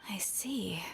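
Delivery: unvoiced muttered aside; whispered aside. Nahida's private aside, whispered under her breath. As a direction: let it slip under your breath.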